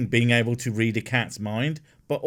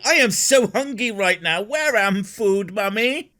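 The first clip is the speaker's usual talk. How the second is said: whiny hungry cat; 9 semitones higher, 3 dB louder than usual. Pretend. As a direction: plead like a starving pet. Cat begging owner for food.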